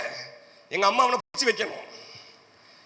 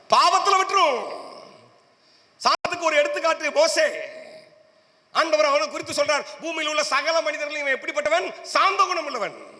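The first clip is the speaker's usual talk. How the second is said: high, ringing sermon declamation; preaching at full pitch; 8 semitones higher than usual. Real, not acted. Preacher declaring scripture's lesson to the congregation, high and emphatic.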